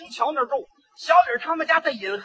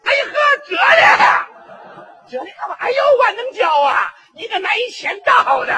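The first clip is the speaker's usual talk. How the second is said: put-on high, loud surprised cry of a young woman; bride's shrill greeting; 8 semitones higher, 6 dB louder than usual. Bride recognizing the neighbour who came to fetch her, shrill and surprised. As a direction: squeal the nickname in surprise. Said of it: "什么口吻呢，这是？".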